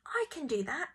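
high, confident interjection; eager grandma. A narrator's reading. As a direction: pipe up as a keen grandmother. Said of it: "said Grandma".

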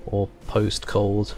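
normal talking voice

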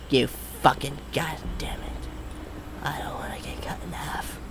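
scared, soft whisper. Real, not acted. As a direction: hide and whisper in fear. Whispering while being chased.

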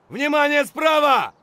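loud urgent shout; shouted warning. Acted. Squad leader warning team of enemy, shouting. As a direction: warn the squad across the noise.

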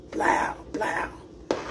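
slow soft whisper, blowing on hot food. Real, not acted. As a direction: whisper it slowly, barely there.

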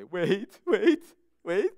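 put-on a toddler parroting her dad; toddler copying.

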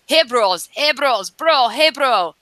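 lightly put-on Russian bro voice; half-hearted Russian thug accent; mock Russian accent. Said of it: "that's my best Russian accent".

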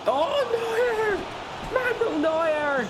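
very high, slow, drawn-out shout of joy; ecstatic yell. Real, not acted. Gamer cheering his keeper's save, ecstatic shouting. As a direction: scream the keeper's name in delight.

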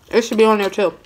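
chewing while talking; muffled by food; mouth full. Real, not acted. Seen 3: Bite at -1.0, then talking with mouth working early on.

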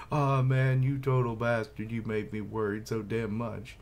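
acted tearful outburst, loud and high then easing; mock-weepy scolding that starts big and settles; breaking down crying. Friend scolds the returned man, breaking down crying. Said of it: "Breaking down crying".

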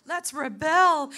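building to a loud, slow let's rebel; rally yourself, then shout it out slowly; eager rebel. Retold or imitated. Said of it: "my heart was immediately like".

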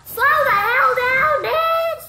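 high loud panicked shout; panicked yell. Real, not acted. Gamer yelling at an enemy ship charging him, panicked shout. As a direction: scream at the chasing enemy, high and frantic.

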